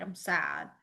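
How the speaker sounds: soft, fading mild sadness; understated sad sign voice. Shown as a demonstration. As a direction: let your voice droop a little. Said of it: "drop your shoulders".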